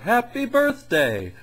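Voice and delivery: very high, stretched-out cartoon snowman cheer; goofy Frosty. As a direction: squeal it like a newborn snowman. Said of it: "Frosty again is like".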